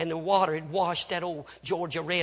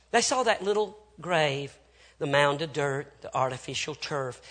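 ordinary talk

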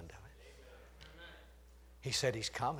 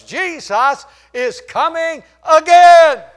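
preaching at full force; 12 semitones higher, 20 dB louder than usual. Acted. Preacher to his congregation, shouting the climax. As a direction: shout the second coming to the rafters.

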